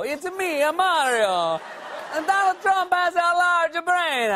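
high, hammed-up Super Mario Italian accent; bad Italian accent. Comic mocking Trump's quote as Super Mario, bad Italian accent. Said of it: "doing a bad Italian accent".

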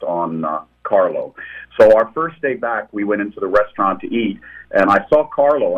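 usual speaking voice

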